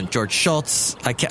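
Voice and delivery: ordinary talk, nothing marked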